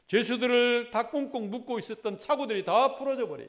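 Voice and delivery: loud, driving pulpit preaching; fervent preaching. Acted. Pastor urging his church not to give in to the devil, fervent preaching. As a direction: press each phrase on the congregation.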